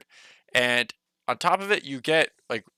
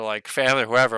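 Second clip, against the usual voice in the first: usual speaking voice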